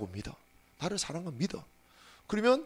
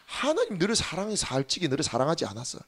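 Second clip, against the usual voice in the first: normal talking voice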